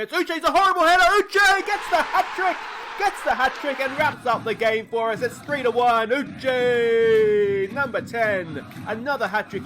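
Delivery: high, shouted hat-trick celebration, gradually easing; loud, soaring football commentator roar; ecstatic goal call. Real, not acted. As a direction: belt out the winning goal with long-held shouts.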